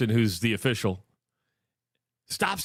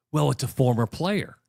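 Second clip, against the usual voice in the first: ordinary delivery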